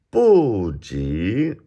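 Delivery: "部" falling from high, "级" rising; fourth then second tone. Shown as a demonstration. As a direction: drop "部" long, then swoop "级" upward. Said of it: "fourth, second".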